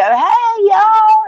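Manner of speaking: high, bright Southern "Hey, y'all" to a neighbor at the store; Southern hello. Retold or imitated. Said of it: "sounded like a Southern".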